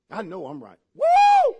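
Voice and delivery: ordinary talk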